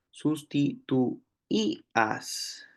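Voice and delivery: sustituías stretched out slowly so each vowel of the í-a hiato stands apart; teacher slowly sounding out sustituías; slow drawn-out demo word. Shown as a demonstration. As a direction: draw out sustituías, separating the i and the a. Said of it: "Podríamos decir que en sustituías".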